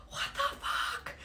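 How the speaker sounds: a whispered, airless what the fuck; breathless whisper. Retold or imitated. Person swept through rapids whispers in shock. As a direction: gasp it out under the breath.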